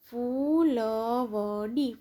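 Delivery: ફૂલવડી very slow, every syllable held long; drawn-out ફૂલવડી, syllables separated. Shown as a demonstration. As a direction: sound out ફૂલવડી slowly for beginner readers. Syllable-by-syllable reading, then whole word.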